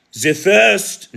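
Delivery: a quick, bad mock German accent, dropped at once. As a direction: start quoting the minister in a cheesy German accent. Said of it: "no, I'm not gonna do a German accent".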